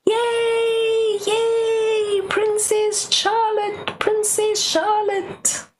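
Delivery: high, slow cheering voices; sing-song townsfolk cheer; crowd cheering. Acted. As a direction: cheer her on from the street.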